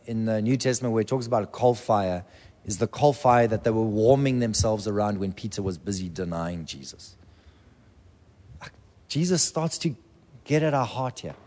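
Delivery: ordinary delivery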